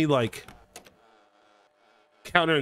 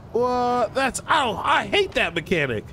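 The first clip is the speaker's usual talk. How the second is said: startled; 11 semitones higher, slower than usual. Real, not acted. Startled by game jump.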